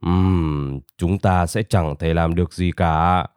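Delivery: put-on low, subdued old-rabbit voice; low, soft, hesitant elder; eldest rabbit. A narrator's reading. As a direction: speak low and resigned, like an elder. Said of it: "Ông thỏ rừng lớn tuổi nhất".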